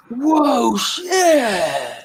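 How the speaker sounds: high, drawn-out tripping whoa; mock stoned awe. Friend on salvia, retold to the stream, in hushed awe. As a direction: imitate a friend lost in a trip.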